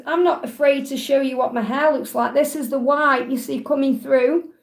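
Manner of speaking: normal talking voice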